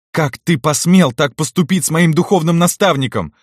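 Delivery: high, outraged cry; straining shout. A narrator's reading. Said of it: "надрывая голос, кричит она". (с надрывом, возмущённый крик)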